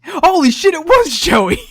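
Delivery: high, loud delighted shout; amazed. Real, not acted. Co-host stunned by the Joey voice, loud delighted shout. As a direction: blurt it out in real amazement. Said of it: "amazed reaction to the reading".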